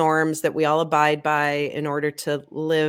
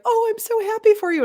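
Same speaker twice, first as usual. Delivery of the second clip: high, fast gushing mother's voice; proud mom; 4 dB louder, faster than usual. Retold or imitated. Mother overjoyed at daughter's assistantship letter, high and gushing. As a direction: gush like a proud crying mother, pitched way up.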